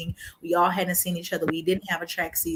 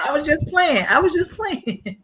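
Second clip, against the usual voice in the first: nervous backpedal; 10 semitones higher, 5 dB louder than usual. Retold or imitated. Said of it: "I was like".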